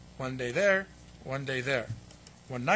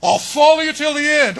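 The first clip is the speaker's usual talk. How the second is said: high, very loud grand hammed-up vow; over-the-top heroic pledge; mock bravado; 9 semitones higher, 16 dB louder than usual. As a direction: puff it up like an empty heroic promise. Mocking the disciples' brave boast.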